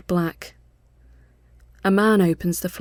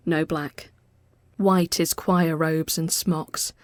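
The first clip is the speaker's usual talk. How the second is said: ordinary delivery, nothing marked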